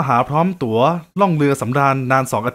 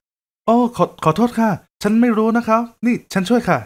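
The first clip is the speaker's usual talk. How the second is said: high, slow, halting woman's apology; flustered apology; 7 semitones higher than usual. Acted. Woman apologizing to a man she thinks is blind, high and halting. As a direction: stammer sorry in a high female voice.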